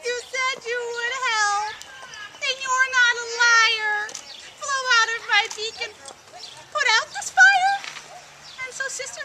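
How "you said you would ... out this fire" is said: high, louder desperate plea building to a cry; rising frantic shout. Acted. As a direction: beg Sister River to put out the fire. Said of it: "he cried out".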